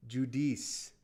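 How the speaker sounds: "Judice" said joo-DEECE; corrected pronunciation. Shown as a demonstration. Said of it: "My bad on pronunciation".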